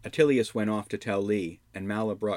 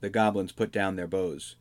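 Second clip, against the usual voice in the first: ordinary delivery, nothing marked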